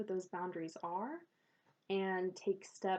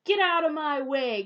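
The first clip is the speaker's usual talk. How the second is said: loud, higher, growled pirate bark; angry pirate; 5 semitones higher, 11 dB louder than usual. Acted. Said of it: "That was a terrible pirate voice".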